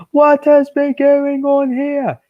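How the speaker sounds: high, slow, loud put-on stern boss voice; stern controller. Kid voicing the controller scolding the engines, stern and loud. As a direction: demand it like an angry railway boss. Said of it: "he said sternly".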